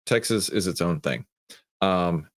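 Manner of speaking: usual speaking voice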